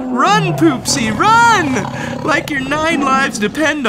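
high, loud urgent call; urgent shout. Acted. Narrator urging Poopsie to flee, a high urgent shout. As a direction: yell at her to run.

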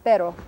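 "Pero" with a single quick tap of the R; non-trilled Spanish R. Shown as a demonstration. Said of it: "the trilled and non-trilled R".

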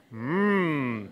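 low soft pondering hmm; thoughtful hmm. Shown as a demonstration. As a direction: hum like a wise man pondering. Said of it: "we say".

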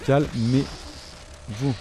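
very slow, stretched calmez-vous; long drawn-out plea. Real, not acted. Gamer begging the fast monsters, calmez-vous stretched slowly. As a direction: drag out every syllable of calmez-vous.

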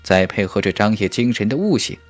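usual speaking voice

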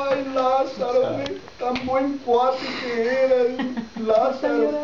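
high, slow, drawn-out mock lament; mock mourners wailing. Mourners weeping over Lázaro, high slow mock wail. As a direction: stretch out the wail like a hammed-up mourner. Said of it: "ellos ahí todos llorando".

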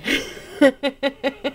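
high burst of giggles; delighted laugh. Real, not acted. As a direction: laugh at your own discovery. Delighted at finding the card.

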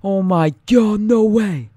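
loud shocked yell; startled shout. Real, not acted. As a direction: yell as danger hits. Near death in game.

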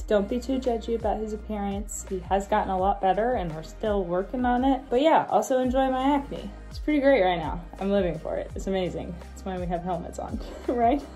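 ordinary talk, nothing marked

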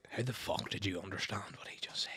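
hushed, disbelieving aside; baffled whisper. Retold or imitated. The boys privately baffled by what they just heard, whispered. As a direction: whisper it low in astonishment.

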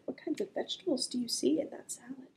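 plain reading voice

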